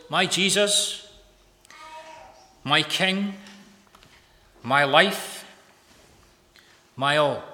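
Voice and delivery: loud, declaimed devotion with long pauses; fervent prayer reading. Acted. Preacher reading Livingstone's birthday prayer, fervent declamation. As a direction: proclaim each title separately, with full conviction. Reads journal prayer aloud.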